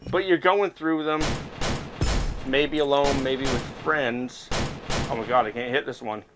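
usual speaking voice

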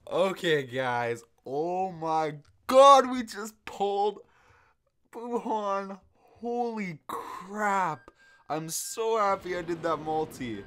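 high, loud, slow drawn-out disbelief; can't believe the pull, savour it; stunned excitement. Real, not acted. Just pulled Buuhan.

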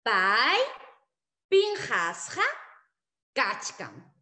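Pai pinjasja kachkan word by word, loud on Pai; spaced model answer. Shown as a demonstration. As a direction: drill with pauses. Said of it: "la respuesta cómo será".

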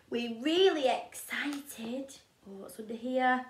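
plain reading voice

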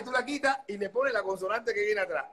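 normal talking voice

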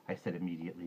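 plain reading voice

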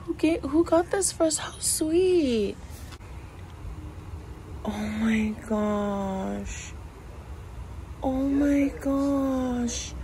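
high, choked-up voice, drawn out; barely holding back happy tears; touched, near tears. Real, not acted. Vlogger finding a gift, high and tearful. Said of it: "I'm gonna start crying".

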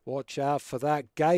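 put-on Aussie accent. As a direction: warn them like a hammed-up Aussie. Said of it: "pretend you put your Aussie accent on".

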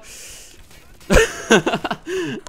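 high, loud burst of laughter; loud cackling laugh; real laugh. Real, not acted. Laughs at own panic.

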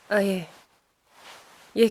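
ordinary talk

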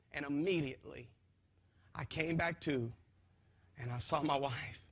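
ordinary talk, nothing marked

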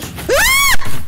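sudden high shrieking scream; scream of fright. Real, not acted. Viewer jolted by jump scare, piercing scream.

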